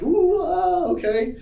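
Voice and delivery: high-pitched mock panicked oohing; mock freak-out. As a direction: ooh and ah as if freaking out. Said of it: "freaking out".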